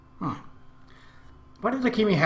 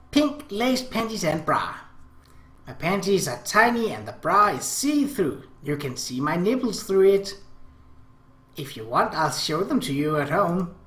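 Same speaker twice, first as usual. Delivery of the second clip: higher, coy little-sister voice; girlish Akemi voice; 3 semitones higher than usual. Acted. Little sister teasing her brother Oni-chan, sweet higher girlish voice.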